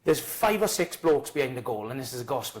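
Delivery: normal talking voice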